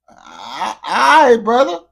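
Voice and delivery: loud high all right brother. Real, not acted. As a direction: shout it the second time.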